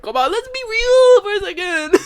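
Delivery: high, loud protest; exasperated appeal. Real, not acted. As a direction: voice soaring in disbelief.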